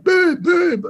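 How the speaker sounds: high-pitched mock Jersey accent; bad Jersey accent. Radio guest imitating Cowboys fans, bad Jersey accent. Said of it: "I can't do the Jersey accent".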